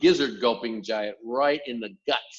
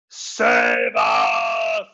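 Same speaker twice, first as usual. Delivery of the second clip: loud, much lower bellowing scream; 12 semitones lower, 11 dB louder than usual. A narrator's reading. Sleeping giant screaming in his nightmare. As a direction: bellow for rescue. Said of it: "screamed the flesh lump eater".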